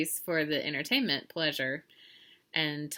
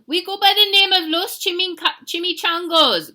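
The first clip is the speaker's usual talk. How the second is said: high, loud cartoon Chihuahua boss; big raised voice for a small dog; gang leader's boast; 12 semitones higher, 13 dB louder than usual. A narrator's reading. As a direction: announce the gang's name forcefully. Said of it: "growled Don Diego".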